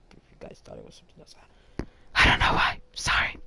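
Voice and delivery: sheepish whispered aside. Real, not acted. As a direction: whisper it as if confessing something embarrassing. Embarrassed aside about knuckle sounds.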